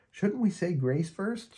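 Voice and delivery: put-on high, slow girl's voice, earnest question; Peppermint Patty asking. A narrator's reading. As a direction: raise the question of grace, pitched high and unhurried. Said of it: "she asks".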